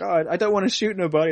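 nervous kid refusing to shoot, hammed-up; Morty impression. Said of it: "he's like".

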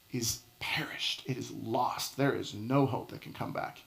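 dropping to a near whisper for effect; hushed. Acted. Preacher hushing the congregation about lost hope. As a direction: let it sink to a hush, all hope gone. Preacher drops to dramatic hush.

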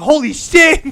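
loud, high amazed exclamation; loud stunned outburst; shocked shout. Retold or imitated. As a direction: blurt it out in shock. Reacting to the tasing.

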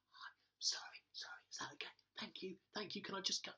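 whispered, apologetic request; mimed apology. Acted. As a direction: mouth a sorry to another driver, almost voiceless. Mimes apologetic driver through window.

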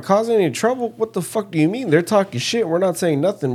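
high-pitched, incredulous protest; indignant retort. Retold or imitated. Player answering director who warned about causing trouble, indignant retort. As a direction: throw the accusation back, pitched high and offended.

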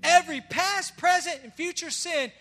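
loud, rising preacher's call to the crowd; full-force preaching. Acted. Pastor preaching forgiveness to his church, loud and fervent. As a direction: preach it out to the congregation.

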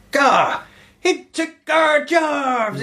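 high, drawn-out slurred holler; hammed-up yokel outrage, words stretched and mangled. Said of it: "these rednecks come".

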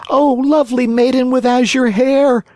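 high, imploring puppet voice; pleading cry. A narrator's reading. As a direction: call up to the window. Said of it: "cried Pinocchio".

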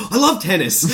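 high, loud gleeful raptor squeal; high giddy laughing dinosaur voice; excited raptor. Retold or imitated. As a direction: squeal it like a thrilled little dinosaur. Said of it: "it's just like".

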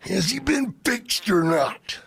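slow, whispery wrestler voice; Macho Man. Retold or imitated. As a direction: whisper it slowly as an intense wrestler. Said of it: "That's a great Macho Man impression".

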